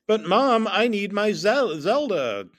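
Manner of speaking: loud, high stuttering whine to mom; pleading kid stumbling mid-word; stuttering plea. Acted. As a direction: plead loudly and trip over the word. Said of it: "Stuttering, I said Zelda".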